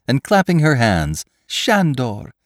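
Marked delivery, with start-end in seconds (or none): 1.5-2.3 overjoyed. A narrator's reading. Girl overjoyed at his return.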